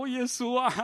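a high startled cry of 耶稣啊. Retold or imitated. Preacher retelling God's reply, high startled exclamation. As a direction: gasp it out, caught off guard. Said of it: "我就吓一跳".

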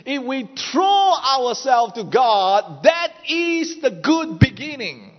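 rising, forceful sermon delivery; preaching. Acted. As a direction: preach it out to the crowd. Preaching builds to a shout.

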